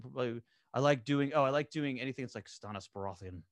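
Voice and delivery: normal talking voice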